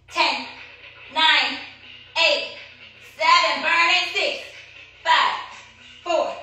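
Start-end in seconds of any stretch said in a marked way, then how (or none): none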